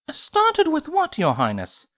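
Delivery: slow, high elegant question; refined lady voice. Acted. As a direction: ask it with genteel poise. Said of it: "Rarity asked".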